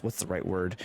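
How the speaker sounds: ordinary talk, nothing marked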